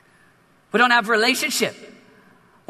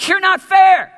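angry outburst; 6 semitones higher, 6 dB louder, slower than usual. Acted. Older son's angry outburst.

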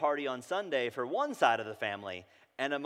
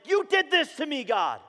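louder accusation pitched much higher; accusing God; 12 semitones higher, 8 dB louder than usual. Retold or imitated. A hurting believer accusing God, loud and high. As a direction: jab the finger and cry it out. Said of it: "pointing the finger at God saying".

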